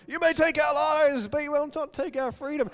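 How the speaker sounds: a hint of a movie hero rallying troops; Braveheart battle cry. Teenage preacher quoting Braveheart to get pumped, mock rousing cry. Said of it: "watching Braveheart going".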